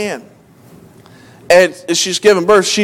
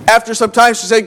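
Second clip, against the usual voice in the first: usual speaking voice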